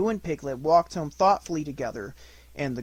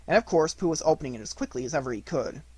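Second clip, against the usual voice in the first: ordinary delivery, nothing marked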